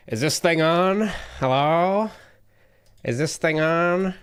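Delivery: put-on high, confused old-timer testing the mic; clueless boomer fumbling with tech; boomer impression. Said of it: "I'm doing my boomer impression right now".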